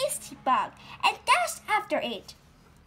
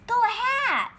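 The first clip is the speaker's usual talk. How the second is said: high and loud, eager; thrilled little voice, high and loud; excited; 10 semitones higher, 6 dB louder than usual. Acted. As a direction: cheer her on like an eager kid.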